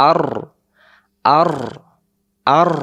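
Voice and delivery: আর said with rounded lips, open a. Shown as a demonstration. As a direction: repeat আর with a round mouth. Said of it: "মুখটা গোল হবে". (গোল মুখে আর)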